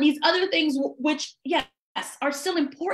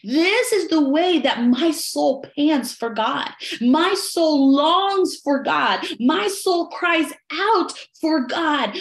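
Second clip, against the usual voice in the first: slow yearning psalm; 2 semitones higher, slower than usual. Acted. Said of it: "the sons of Korah are saying".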